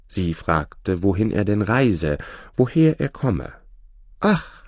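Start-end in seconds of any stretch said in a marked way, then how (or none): 4.2-4.6 sighed ach. A narrator's reading. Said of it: "seufzte der junge Gesell".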